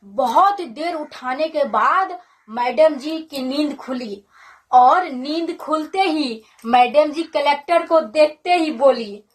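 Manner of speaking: normal talking voice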